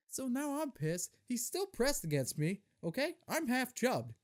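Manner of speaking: ordinary delivery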